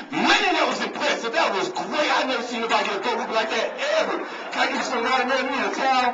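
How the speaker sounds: high, excited, wide-eyed praise; amazed police sergeant. Retold or imitated. Desk sergeant praising the mother after the beating, high amazed voice. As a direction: gush like a stunned desk sergeant in awe.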